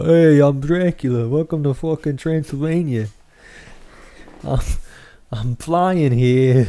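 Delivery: high, loud hammed-up Transylvanian vampire accent; bad Dracula accent. Teasing friend's bad accents with a hammed-up Dracula, slowing on the last words. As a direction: ham up a cheesy Dracula accent. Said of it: "you just can't tell what accent I'm doing".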